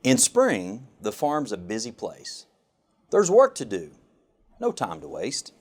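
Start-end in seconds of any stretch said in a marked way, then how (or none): none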